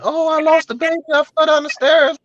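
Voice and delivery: high, whiny fake sob voice; mock crying girlfriend. Girlfriend crying on the phone about losing the baby, high mock sob. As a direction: mimic her crying on the phone. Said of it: "She called me crying".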